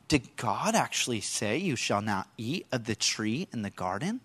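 low, hushed, sly serpent voice. Acted. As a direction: drop low and coax like a tempting snake. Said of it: "He said to the woman".

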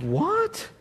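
very high, soft baffled "What?". Retold or imitated. Worker stunned by the German reply, high and soft. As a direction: squeak it in disbelief.

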